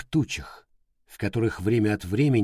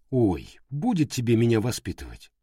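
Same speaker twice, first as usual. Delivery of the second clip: plain reading voice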